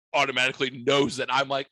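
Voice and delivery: normal talking voice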